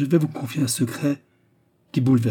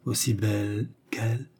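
understated tremor, softer; trembling voice; 5 dB quieter than usual. A narrator's reading. Woman comparing herself to his wife, trembling voice. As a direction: ask it with a slight tremble. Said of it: "sa voix tremblait, tandis qu'elle prononçait ces mots".

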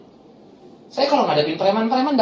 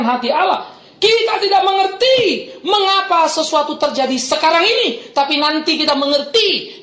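high, loud preaching climb; preaching at full force; 9 semitones higher, 4 dB louder than usual. Real, not acted. Pastor exhorting his congregation, loud fervent preaching. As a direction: lift the congregation with fervour.

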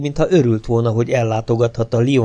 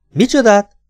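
startled question; 11 semitones higher, 6 dB louder than usual. Acted. Bewildered Kocsubej in the car.

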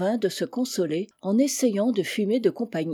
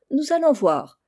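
higher, louder outburst; challenging exclamation; 6 semitones higher, 5 dB louder than usual. A narrator's reading. Charlie takes up his cousin on her promise, exclaiming. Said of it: "s'écria Charlie".

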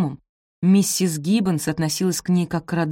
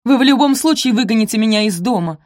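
higher, louder defiance; full voice; 6 semitones higher, 7 dB louder than usual. A narrator's reading. Maid being evicted defies her mistress, full voice. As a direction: speak up with nothing to lose. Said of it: "уже в полный голос бросила Софи".

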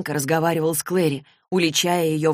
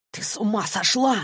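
high, held-back hiss; tense acted hiss, pitched up; hissed reproach; 5 semitones higher than usual. A narrator's reading. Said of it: "зашипел он".

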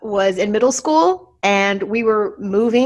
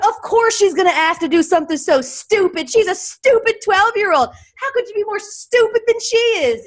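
much higher, exasperated tirade; scolding dad; 13 semitones higher than usual. Retold or imitated. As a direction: rant it up high as a fed-up father. Dad scolding older sister.

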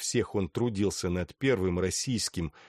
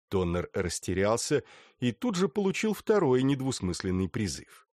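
plain reading voice

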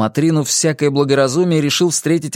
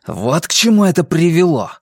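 raised, denouncing exclamation; mildly heated accusation; accusing; 4 semitones higher, 3 dB louder than usual. A narrator's reading. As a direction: point out the blame. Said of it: "он вновь заговорил обличающе".

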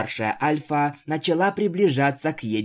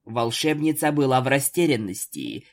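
ordinary delivery

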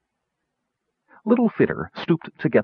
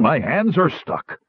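higher, drawn-out complaint; complaining; 6 semitones higher, slower than usual. A narrator's reading. Gnome stuck to a pole complains to his companions, complaining. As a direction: complain slowly with the pitch lifted. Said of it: "Roperig complained".

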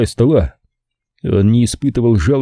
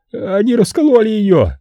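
yelling in panic; 11 semitones higher than usual. Acted. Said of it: "он заорал".

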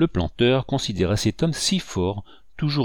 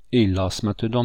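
ordinary delivery, nothing marked

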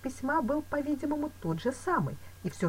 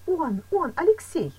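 high, louder burst of joy; overjoyed; 12 semitones higher, 7 dB louder, slower than usual. A narrator's reading. Woman naming her rescued lover, overjoyed. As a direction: blurt the beloved name out.

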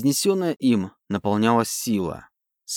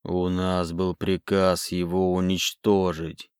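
very slow, low, soft voice from the grave; grim reaper voice; 5 semitones lower, 4 dB quieter, slower than usual. A narrator's reading. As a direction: sepulchral undead voice. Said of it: "замогильный голос".

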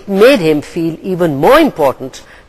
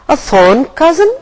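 mock innocence, lilting higher; innocent; 9 semitones higher than usual. A narrator's reading. Raven asking Gull with mock innocence. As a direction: Raven feigning concern, higher innocent question. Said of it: "asked Raven innocently".

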